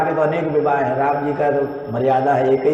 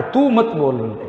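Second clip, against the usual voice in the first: ordinary talk, nothing marked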